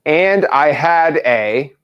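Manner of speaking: very slow, drawn-out dictation; dictating. Shown as a demonstration. Coach feeding trainee the next words, very slow dictation. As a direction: stretch each word for the writer. We're gonna write it.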